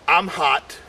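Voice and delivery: ordinary delivery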